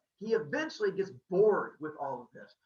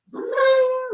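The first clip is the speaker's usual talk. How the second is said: very high, loud ringing bling; ringing strum noise; 9 dB louder than usual. Acted. Storyteller imitating Apollo strumming the lyre, high ringing sound. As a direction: sing out one long high ping.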